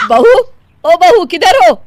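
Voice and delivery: high, loud summons; calling out. Acted. Mother-in-law looking for daughter-in-law, calling out. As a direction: call across the house for her.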